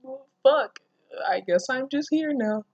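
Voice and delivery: high, deadpan resigned voice; mock resigned girl. Reader mocks Shizuka's passive reaction. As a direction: mimic her giving up. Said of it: "She's just like".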